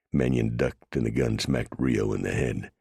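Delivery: ordinary delivery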